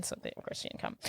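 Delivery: breathy whisper; whispered aside. Real, not acted. Commenting on the movie, a whispered aside to her friend. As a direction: toss it off under your breath.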